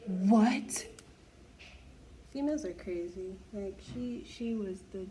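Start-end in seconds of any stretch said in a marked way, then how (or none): 0.1-0.9 shocked what. Real, not acted. Reacting to the story.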